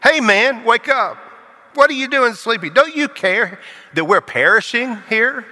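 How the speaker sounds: high, urgent cry of panic; frantic disciples waking Jesus; panicked disciples. Acted. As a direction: shout over the storm in alarm.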